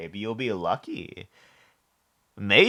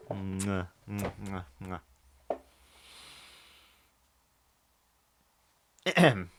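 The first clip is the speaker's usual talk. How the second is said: between kisses; 5 dB quieter, much slower than usual. Real, not acted. Blowing kisses to mommy.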